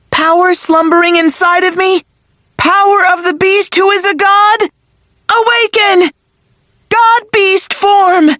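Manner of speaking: rising battle chant, high and strong; incantation shout. Acted. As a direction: call out the spell with mounting power. Fran chanting an awakening incantation.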